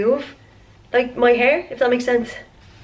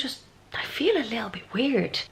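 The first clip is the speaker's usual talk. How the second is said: whisper; 8 dB quieter than usual. Real, not acted. Unwell, whispered.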